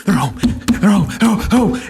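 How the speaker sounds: excited dog greeting its owner. Acted. Preacher acts a dog greeting its returning owner, excited dog. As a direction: pant and yelp like an overjoyed dog. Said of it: "what does the dog do".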